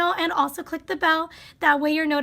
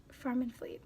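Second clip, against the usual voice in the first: doubtful mutter; 4 semitones lower, 13 dB quieter than usual. Real, not acted. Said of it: "What? No, wait".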